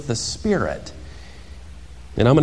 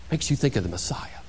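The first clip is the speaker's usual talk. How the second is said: whispered coyly; mock whisper; 2 semitones lower, 9 dB quieter than usual. John imagined whispering to the priests, coy and flattered. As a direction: lean in and breathe it. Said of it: "He could have answered".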